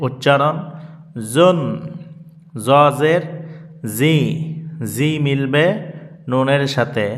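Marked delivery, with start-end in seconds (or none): none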